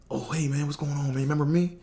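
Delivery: put-on high, bright-eyed hello to an old acquaintance; friendly excited greeting. Greeting doorman he met before.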